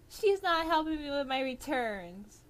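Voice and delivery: high-pitched tattling complaint; whiny complaining customer. Retold or imitated. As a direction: whine like an entitled customer. Customer complains about cashier.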